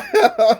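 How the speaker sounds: sharp high burst of laughter; short loud laugh. Real, not acted. Quote breaks into a loud laugh. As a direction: laugh loudly.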